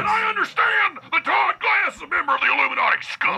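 high ranting conspiracy voice; Alex Jones impression. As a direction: yell it like a paranoid host. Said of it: "It's Alex Jones".

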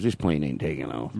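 low, quiet doom-laden mutter; gloomy local warning. Retold or imitated. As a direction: warn them the plane won't go, low and grim.

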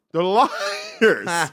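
laughing through the words; mock Patrice, laughing; laughing repeat. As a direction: echo it while cracking up. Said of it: "He said that as loud as he could".

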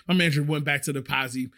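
ordinary delivery

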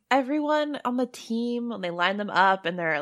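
ordinary delivery

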